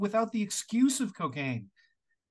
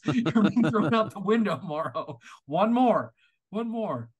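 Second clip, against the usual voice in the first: mock stern warning; 2 semitones higher, 4 dB louder than usual. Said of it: "if he came over to your house".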